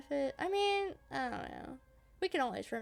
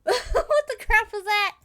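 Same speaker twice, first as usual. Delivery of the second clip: loud, slow laughing disbelief; startled laugh; 9 semitones higher, 14 dB louder, slower than usual. Real, not acted. Player startled by the strange noise, laughing shock. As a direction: laugh it out, caught off guard.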